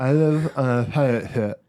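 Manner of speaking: words mangled by a held tongue; holding tongue with fingers. Shown as a demonstration. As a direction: say it while gripping your tongue. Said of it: "Should I do it on air?".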